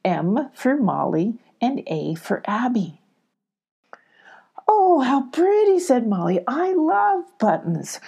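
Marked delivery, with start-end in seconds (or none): 4.7-5.8 put-on high, breathy girl's delight; a small girl gasping at something pretty; Molly's voice. Molly admires the button. 6.5-8.0 lightly voiced little girl, slow and pleased; Molly's voice. Molly telling the cat she loves buttons, lightly voiced.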